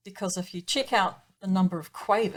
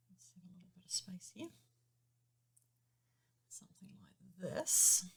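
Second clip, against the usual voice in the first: whispered aside; 9 dB quieter than usual. Real, not acted. Aside while writing on screen.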